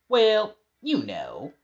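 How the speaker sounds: high, loud mock old wrestling promoter; Jerry Jarrett impression. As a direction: ham up a slow folksy promoter. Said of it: "doing that good impression of Jerry Jarrett".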